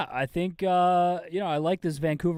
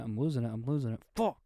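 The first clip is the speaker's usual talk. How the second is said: ordinary talk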